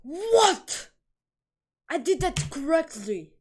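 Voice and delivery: high, loud indignant cry; real shocked outburst at a wrong call; outraged disbelief. Real, not acted. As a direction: protest at the game, stunned. Gaming fail outcry.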